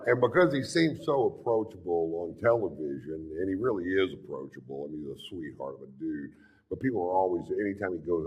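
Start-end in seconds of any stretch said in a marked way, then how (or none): none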